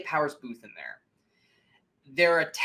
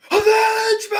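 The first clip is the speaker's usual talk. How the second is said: mock scream; 11 dB louder, much faster than usual. Movie fan imitating the captive father's outburst, mock scream. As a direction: cry it out like an overacting film actor. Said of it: "scream at the top of your lung".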